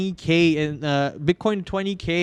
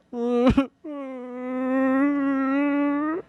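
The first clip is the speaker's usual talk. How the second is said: high laugh; 9 semitones higher than usual. Real, not acted. Laughing at his crashing portfolio.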